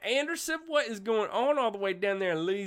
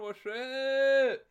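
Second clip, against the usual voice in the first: ordinary talk, nothing marked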